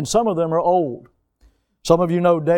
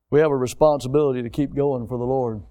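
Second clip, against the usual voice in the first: ordinary talk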